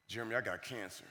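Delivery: low, very soft disclosure; hushed, sunken voice; grave confession. Retold or imitated. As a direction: imitate a teacher quietly breaking terrible news. Teacher reveals cancer diagnosis.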